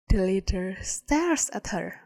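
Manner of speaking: usual speaking voice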